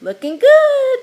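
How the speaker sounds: very high, loud squawking parrot repeat; loud parrot chatter. A narrator's reading. Parrot Petey echoing Meg, loud high squawk. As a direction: screech it back like an excited parrot. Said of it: "chattered Petey".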